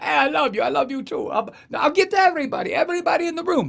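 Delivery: high hammed-up schmoozing voice; mock Scaramucci promising the room, high and fast; gushing Scaramucci impression. Impersonating Scaramucci at podium.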